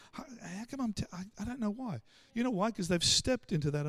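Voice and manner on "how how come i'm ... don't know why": soft, halting confession; hushed, hesitant admission; imitated bewildered confiding. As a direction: stammer it softly, puzzled at yourself.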